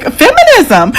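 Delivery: high, drawn-out mock cheer; approving exclamation. Reacting to the dowry rule, a high mock cheer. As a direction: play it as a delighted verdict. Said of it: "I was like".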